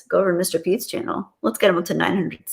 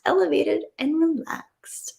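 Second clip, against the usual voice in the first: breathy, slow, airy whisper; dreamy whisper; 9 semitones higher, 4 dB quieter than usual. Pretend. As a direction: savour the words in a whisper. Repeating chat words dreamily.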